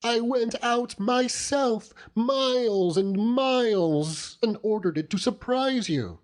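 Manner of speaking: hurt little wife scolding her husband; lightly voiced young wife. A narrator's reading. Wife chiding her husband over the fish, lightly voiced.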